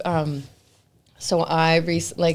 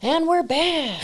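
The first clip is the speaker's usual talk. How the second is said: high, slow host announcement; playful broadcast voice; announcer back; 9 semitones higher, slower than usual. Pretend. Stream reconnects after glitch.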